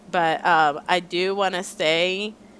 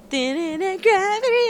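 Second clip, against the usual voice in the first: excited fan; 13 semitones higher, slower than usual. Retold or imitated. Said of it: "I'm just like".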